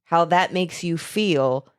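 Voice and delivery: ordinary talk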